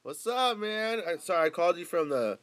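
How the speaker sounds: high, very loud and eager; loud phone chat. Real, not acted. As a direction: talk big down the phone to a buddy. Excitedly explaining the call to friend.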